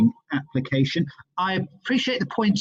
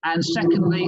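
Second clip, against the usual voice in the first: ordinary talk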